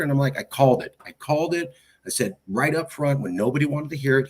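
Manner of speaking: ordinary talk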